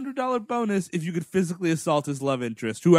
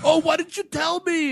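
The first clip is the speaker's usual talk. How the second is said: higher, whiny, put-upon complaint; whiny LaRusso; 11 semitones higher, 4 dB louder than usual. Retold or imitated. LaRusso whining at Miyagi about the tree. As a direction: whine like a spoiled kid.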